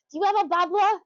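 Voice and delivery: put-on little kid voice. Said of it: "and he goes".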